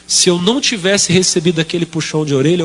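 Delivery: normal talking voice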